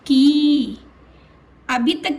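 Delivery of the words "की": की held long and loud; lengthened की. Shown as a demonstration. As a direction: stretch the ee in की. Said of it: "और बड़ी".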